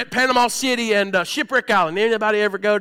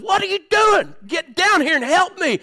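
mock scream for help; 9 semitones higher than usual. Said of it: "I was screaming for help".

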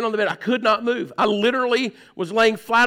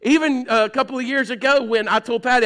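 ordinary delivery

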